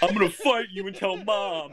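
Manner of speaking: whiny little brother. Retold or imitated. Mocking a bratty little brother.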